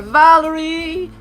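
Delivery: ordinary talk, nothing marked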